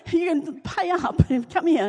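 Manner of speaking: teasing demand. Retold or imitated. Mock-demanding daughter's help, laughing.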